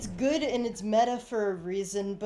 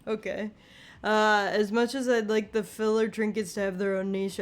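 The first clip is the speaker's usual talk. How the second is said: ordinary talk, nothing marked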